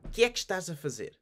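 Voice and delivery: fast, clipped together; native speed. Shown as a demonstration. Teacher repeating the authentic version, fast. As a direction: rattle it off naturally. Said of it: "as authentically and genuinely as possible".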